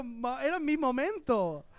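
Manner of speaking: high, drawn-out whiny complaint; mock indignant protest. Son to mother who outed him, mock indignant. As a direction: stretch it out, playfully aggrieved.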